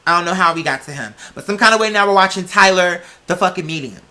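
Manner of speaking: usual speaking voice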